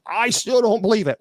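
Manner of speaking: high, whiny mock of a stubborn skeptic; mocking doubter. Mocking a viewer who doubts ghosts, high whiny sneer.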